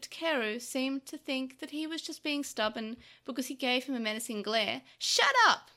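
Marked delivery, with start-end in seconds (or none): 5.0-5.7 high, very loud angry shout; vicious. A narrator's reading. Takeru silences Tokomon's protests, viciously. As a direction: silence him with a vicious yell. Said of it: "That vicious tone".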